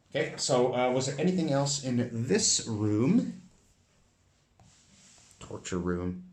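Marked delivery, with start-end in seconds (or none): none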